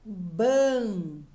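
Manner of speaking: "Ban" held long and swelling; stressed syllable alone. Shown as a demonstration. Teacher answers which syllable was tonic, stressing a long "Ban". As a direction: stretch "Ban" out as the strong syllable. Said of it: "qual foi a sílaba tônica que eu li?".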